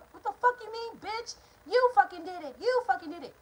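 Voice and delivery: high-pitched accusing yell as mom; imitating mom's high, furious accusation; furious mother. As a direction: screech the accusation like an enraged mother. Mom accuses her of the robbery.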